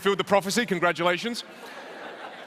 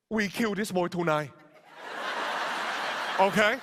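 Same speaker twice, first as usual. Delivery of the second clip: lightly put-on Vietnamese accent, calm and soothing; a father gently reassuring his wife while plotting; Vietnamese dad accent; 2 semitones lower, 4 dB quieter than usual. Dad calmly reassuring mum in the kitchen, mock Vietnamese accent. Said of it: "saying something like this".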